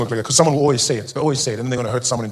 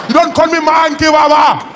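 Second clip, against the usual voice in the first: egging friend; 12 semitones higher, 7 dB louder, slower than usual. Retold or imitated. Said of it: "We talk".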